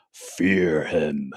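low, ominous trailer sign-off; movie trailer voice. Pretend. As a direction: deliver it like the final line of a trailer. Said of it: "some kind of sort of movie trailer voice".

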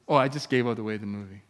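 ordinary talk, nothing marked

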